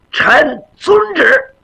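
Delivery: high, drawn-out formal acknowledgement; obedient young warrior. Acted. Young warrior accepts the emperor's order to perform, obedient and ceremonious. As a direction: stretch each word high, like a ceremonial reply.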